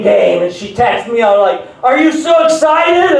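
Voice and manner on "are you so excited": high, bubbly excited wife; mock-excited wife voice. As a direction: gush like a thrilled wife.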